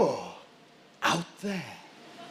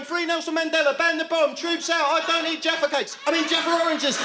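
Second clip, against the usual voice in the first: frantic slogans; 6 semitones higher, faster than usual. Pretend. Pleading captive shouting slogans.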